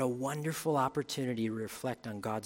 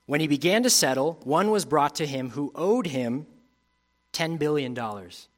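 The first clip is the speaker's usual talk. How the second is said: measured Bible reading to the congregation; scripture reading; 5 dB louder than usual. Acted. Preacher reading scripture to the congregation, measured reading. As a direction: read the parable out to the church. Reads the parable on.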